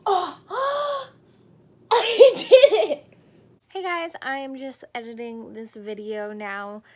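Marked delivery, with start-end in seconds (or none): 0.0-3.0 high, loud laughing-crying shout; elated shriek. Real, not acted. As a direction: scream with joy at success. Lands handstand, elated.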